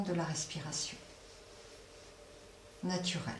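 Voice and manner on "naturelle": usual speaking voice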